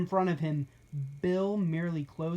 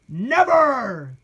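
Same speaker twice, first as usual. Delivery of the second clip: loud, rising defiant shout; defiant cry; 7 semitones higher, 12 dB louder than usual. A narrator's reading. Captives refusing Bill, loud defiant shout. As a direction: refuse with all their might. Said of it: "they cried".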